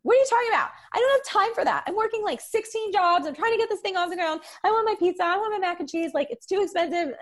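high, loud mock whine; younger self whining. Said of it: "And I was like".

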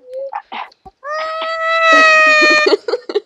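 long, very high wailing squeal; drawn-out piercing squeal through a voice changer; high-pitched shriek. Pretend. Playing with high voice effect.

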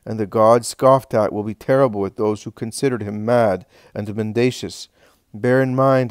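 solemn, declaiming sermon voice; preaching. Acted. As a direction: proclaim it like a preacher. Jesus preaching to crowd.

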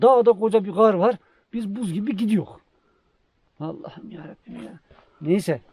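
ordinary talk

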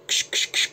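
airy ksh-ksh-ksh like a pump sprayer; breathy spraying noise; spray hiss imitation. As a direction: mimic the spritz of the bottle.

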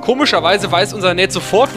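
ordinary talk, nothing marked

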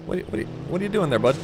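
ordinary talk, nothing marked

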